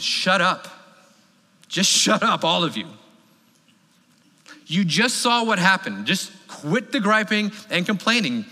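Moses snapping at his griping people to shut up; emphatic blunt rebuke. Retold or imitated. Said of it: "he's not polite about it".

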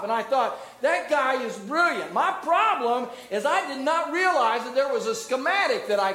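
high, loud preaching, stretching the key words; preacher driving the schematic lesson home to the congregation, full-force preaching. Acted.

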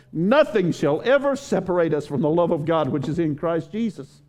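high, building preacher's cry; preaching at full force. Real, not acted. Preaching climax, rising intensity.

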